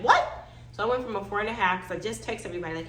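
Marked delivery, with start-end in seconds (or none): 0.0-0.5 high, loud yelp of disbelief; shocked. Retold or imitated. Patient hears how far she's dilated, shocked. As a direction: blurt it out in shock. Told her surprising dilation.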